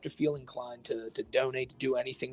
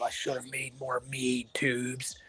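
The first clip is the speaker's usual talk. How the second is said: slow, soft, lightly voiced streamer impression; Cobra impression; 2 semitones lower, 8 dB quieter, slower than usual. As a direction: drag it out, mumbling and quiet. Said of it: "every time I do a Cobra impression".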